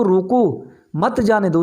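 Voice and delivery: slowly, "रोको" alone, then "मत जाने दो"; deliberate break right after रोको; pause after रोको. Shown as a demonstration. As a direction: set रोको apart, run मत into जाने दो. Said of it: "उसको जाने नहीं देना है".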